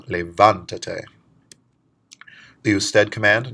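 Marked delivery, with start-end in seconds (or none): 0.0-1.0 levántate said slowly and louder, stress on the VAN syllable; stress on VÁN. Shown as a demonstration. Teacher shows the stressed vowel three syllables back, slow and louder. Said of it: "Three syllables back on the attached pronoun".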